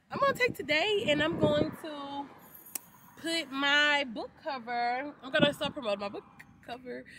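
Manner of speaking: bubbly, rising with excitement; giddy about the book launch; excited. Real, not acted. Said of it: "'cause I be getting too excited".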